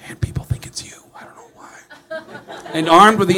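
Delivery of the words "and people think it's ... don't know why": hushed, breathy tip-off; secretive whisper. Retold or imitated. Someone secretly tipping off Gene, whispering. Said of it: "someone finally told him".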